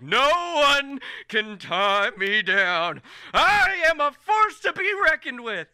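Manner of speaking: grand, high, loud proclamation; Colm. Acted. Future king declaring himself to the guards, triumphant proclamation. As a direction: declaim like a triumphant hero rallying crowds. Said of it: "and Colm's like".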